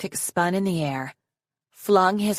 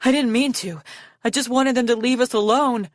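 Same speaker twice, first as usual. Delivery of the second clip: high, pleading excuse; desperate; 6 semitones higher, 4 dB louder than usual. A narrator's reading. Arctic clutching Foeslayer after the killing, desperate. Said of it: "clutching her arms desperately".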